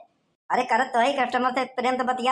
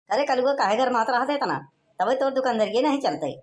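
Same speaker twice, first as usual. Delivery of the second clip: ordinary talk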